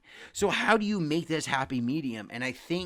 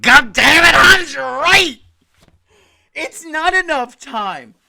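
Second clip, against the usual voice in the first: shouted, high and slow: "God damn it, Hans"; a real frustrated yell at his friend; exasperated outburst; 13 semitones higher, 18 dB louder, slower than usual. Real, not acted.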